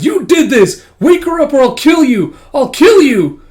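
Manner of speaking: high, furious full-voiced scream; tearful fury. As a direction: scream the threat at the top of your voice. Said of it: "tears streaming down her face".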